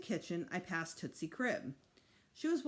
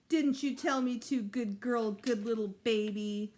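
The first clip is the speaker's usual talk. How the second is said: put-on cooing to a baby; 4 semitones higher, 5 dB louder than usual. A narrator's reading. Boy cooing to baby sister.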